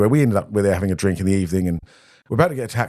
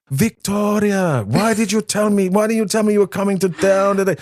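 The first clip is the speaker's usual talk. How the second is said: mum calling from afar; 10 semitones higher than usual. Retold or imitated. Mother spotting her daughter across the bar, high delighted calling. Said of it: "I heard in the distance".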